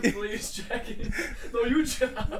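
very slow, laughing replay; laughing mock quote. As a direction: reenact it through giggles. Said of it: "I was like".

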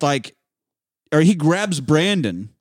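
ordinary talk, nothing marked

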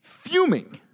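high, squeezed burst of exasperation; fuming. Retold or imitated. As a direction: let the word burst out high. Acts out anger at missing film.

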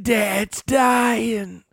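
high, slow squeaky put-on voice; high movie character voice. Quoting the movie line in a high voice.

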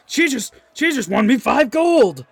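high, loud, slow delighted cheer from the crowd; a gleeful gambler in the crowd; cheering bettor. Acted. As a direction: shout your glee over the winnings. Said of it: "you just hear cheering from the crowd".

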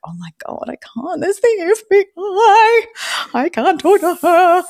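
hushed start rising to a high mock wail; hammed-up squeal of nerves; retold nervous panic. Host dreading talking to the FBI, retold panic. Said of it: "So nervous".

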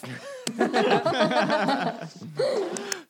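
long, high laugh. Real, not acted. Hurt player cracks up with the group, laughing. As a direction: dissolve into drawn-out high laughter.

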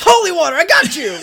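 very loud, high triumphant yell; gotcha shout. Retold or imitated. Exorcist springs his holy water trick, mock triumphant shout. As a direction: explode into a hammed-up gotcha.

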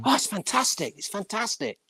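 high, gushing fantastic; gushing praise. Retold or imitated. Gushing client voiced in anecdote.

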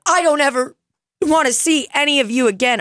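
put-on high, bratty kid voice; Kevin McAllister. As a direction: throw a kid's tantrum line up high. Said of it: "just like Kevin McAllister in Home Alone".

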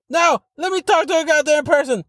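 boiling over at the robot; furious yell. Retold or imitated. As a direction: caller losing it with the phone system. Arguing with a phone robot.

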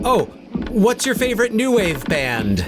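high-pitched mock interviewer call; playful quizzing. Co-host feeding joke questions to the screaming film character, playfully called out. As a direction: toss out the question high and eager. Joking setups for clip's shouted "Blondie.

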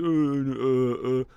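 mock mumbling, half-swallowed uhs; mumbling kid. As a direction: imitate a caught kid mumbling. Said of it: "he just does his mumble mumble".

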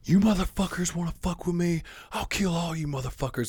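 high, raging mock threat at the class; retold furious threat. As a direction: spit it out like a kid who snapped.

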